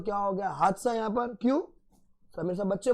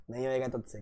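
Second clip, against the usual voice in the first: taunting relatives; 6 semitones lower, 7 dB quieter, faster than usual. Retold or imitated. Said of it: "पूरी journey में taunt मारा उसको".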